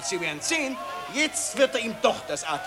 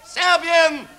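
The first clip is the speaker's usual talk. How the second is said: loud, slow, higher final slogan; shouted climax; 6 semitones higher, 9 dB louder, much slower than usual. Acted. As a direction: hammer home the last line. Climax of patriotic speech. (lauter, langsamer Schlussruf)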